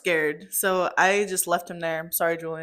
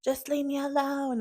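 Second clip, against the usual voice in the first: retold mild scream; 7 semitones higher than usual. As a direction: imitate an upset yell at ordinary volume. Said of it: "started screaming at me".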